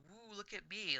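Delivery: high, showy mock wizard; mocked magic user. Elf player mocking stick-waving magic users, high show-off voice. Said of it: "little stick in its hand going".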